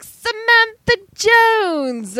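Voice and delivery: high, loud, drawn-out mock greeting; gushing greeting. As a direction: greet an acquaintance theatrically, stretching the name. Voices an acted gushing greeting.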